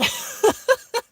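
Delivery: a sudden high cackle at her own refusal; high loud burst of laughter. Real, not acted. Vlogger laughing at herself about backpacking, high burst of laughter.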